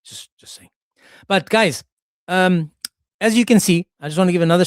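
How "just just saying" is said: unvoiced sly whisper; whispered after a cheeky joke; whispered aside. Real, not acted. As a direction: slip it in under the breath.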